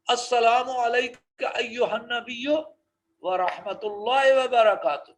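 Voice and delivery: slow, measured Arabic recitation. Shown as a demonstration. Teacher reciting the greeting for students, slow. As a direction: intone it slowly and clearly. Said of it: "آپ گھر میں داخل ہونا... یہی پڑھ".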